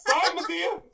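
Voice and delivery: squeaky high mock-frightened apology; a timid kid apologizing to an angry Madea; mock scared apology. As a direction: cower and squeak out sorry.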